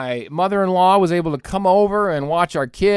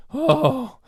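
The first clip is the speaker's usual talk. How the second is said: ordinary talk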